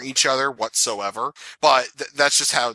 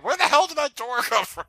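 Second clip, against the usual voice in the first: incredulous; 10 semitones higher than usual. Retold or imitated. Mock outrage at door.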